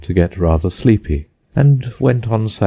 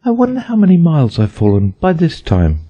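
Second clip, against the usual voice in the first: put-on higher girlish voice, wondering; Alice aloud; 9 semitones higher than usual. A narrator's reading. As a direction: voice a curious little girl. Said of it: "she said aloud".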